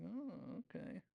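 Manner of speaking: high-pitched, soft "oh"; feigned interest. Retold or imitated. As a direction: imitate a polite, taken-aback shopper. Retold reaction to a costly price.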